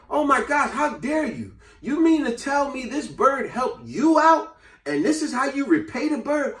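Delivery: high, indignant scolding; how dare you, pitched well up; appalled wife scolding husband. Retold or imitated.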